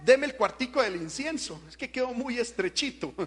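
lightly put-on needy voice; Tobías. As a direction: wheedle for a little room, hammed up. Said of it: "Tobías le dijo".

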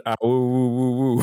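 a light wolf howl sounded out; mock howl. Shown as a demonstration. Host corrects co-host by showing his howl, lightly. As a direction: howl like a werewolf, playful. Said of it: "No, I said".

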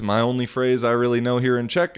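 usual speaking voice

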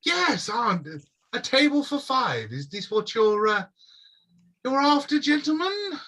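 high, loud, sing-song shopkeeper; a prim elf ushering guests to a table. Acted. Elf shopkeeper seating five gentlemen, high and sing-song.

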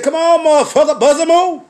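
hammed-up street challenge; mock fight challenge. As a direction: call him out to fight. Confronting the vagrant, loud challenge.

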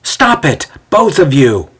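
high, exasperated order; demanding. A narrator's reading. Othello to bickering Ezio and Xander, demanding. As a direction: cut off the squabble firmly. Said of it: "Othello demands".

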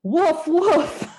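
lightly voiced, deadpan Muppet dog; mock dog woof.

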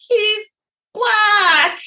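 high wail with stretched words; mock bawling. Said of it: "I'm, like, bawling and calling".

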